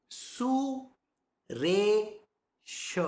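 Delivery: "Su, Re, Sh" separated, Re held a little longer; syllable by syllable. Shown as a demonstration. Teacher breaking down a name for students, syllables spaced apart. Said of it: "The Su is short".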